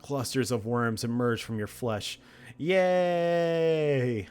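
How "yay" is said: high, loud mock yay; sarcastic cheer. Real, not acted. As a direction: cheer with fake delight. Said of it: "sarcastic cheer".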